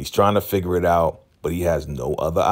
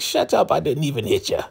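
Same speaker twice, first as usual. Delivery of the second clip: high-pitched imitation of a scolding elder; put-on high voice, dismissing the screamer; hammed-up exasperated telling-off; mock irritated retort; 9 semitones higher than usual. Elder snapping at screaming victim.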